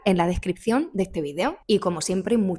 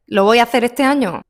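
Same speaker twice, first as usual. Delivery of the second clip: teasing question; 3 semitones higher, 9 dB louder, faster than usual. Real, not acted. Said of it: "¿Qué pensáis?".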